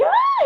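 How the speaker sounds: imitating a startled baby, a very high, loud shriek; baby's scream. Retelling how the nephew screamed at her, shrill scream. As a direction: copy the baby's frightened cry. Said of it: "aí ele fez assim".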